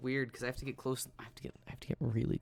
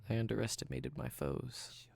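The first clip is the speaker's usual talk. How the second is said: sultry close-mic voice. Acted. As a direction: lean into the mic, intimate. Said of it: "my sultry speaking voice".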